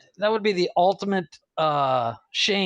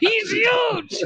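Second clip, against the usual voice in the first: high, hammed-up shout; mock alarmed shout.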